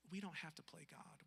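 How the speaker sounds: ordinary talk, nothing marked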